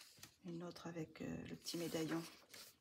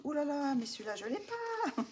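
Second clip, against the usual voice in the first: higher, louder, thrilled "oh là là"; excited exclamation; 9 semitones higher, 4 dB louder than usual. Retold or imitated. Recalling spotting the book in a video, excited. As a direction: relive the delight of spotting a book you lack. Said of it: "je me suis dit".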